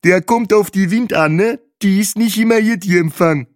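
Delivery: high, loud, explaining voice; eager Bahe character. Acted. Bahe explains the campsite reception, high and loud. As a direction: pitch it right up and explain keenly.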